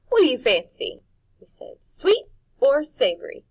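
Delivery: a showy cook admiring his guest; Jive voice. A narrator's reading. Jive voiced in read story.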